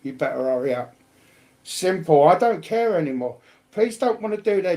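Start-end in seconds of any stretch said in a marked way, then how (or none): none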